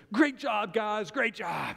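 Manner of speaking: cheering the team after the fight; proud cheer. Retold or imitated. Daughter praising her sisters, loud and pleased. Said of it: "turns around and goes".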